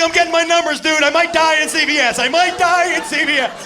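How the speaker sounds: hammed-up terrified brother, loud; panicking brother. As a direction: wail it like he's dying. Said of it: "He was like".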